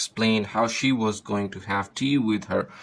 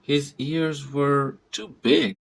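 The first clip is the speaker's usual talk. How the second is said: put-on girlish voice; 4 semitones higher, slower than usual. A narrator's reading. Girl laughing, hugging grandmother.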